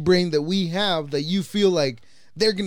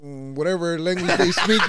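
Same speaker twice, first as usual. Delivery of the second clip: normal talking voice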